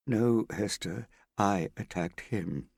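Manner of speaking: slow, a lightly voiced weary father; heavy confession. Father correcting Hester, slow and lightly heavy. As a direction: let the guilt drag each word.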